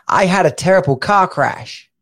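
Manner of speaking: a light English accent, car crash said clearly; saying it the way an English bloke would. Shown as a demonstration. For a Chicago ear, a light English accent. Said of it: "put your kind of Chicago American ear accent filter on".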